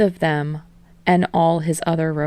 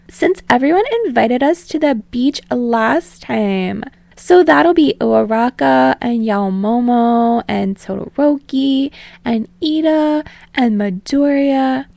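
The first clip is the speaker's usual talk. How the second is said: high, loud party pitch; high loud excited girl; excited Ashido; 7 semitones higher, 5 dB louder than usual. Acted. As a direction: pitch it up, thrilled.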